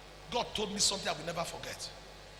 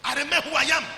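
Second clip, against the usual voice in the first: loud; 7 semitones higher, 8 dB louder, faster than usual. Shown as a demonstration. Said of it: "my speech has not been influenced".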